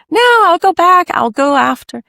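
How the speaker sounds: brushing off the surgeon with a high, loud laugh in the voice; dismissive high protest. Retold or imitated. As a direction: shoot up high and loud, waving it off.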